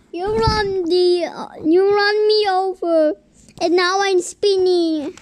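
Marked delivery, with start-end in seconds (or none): none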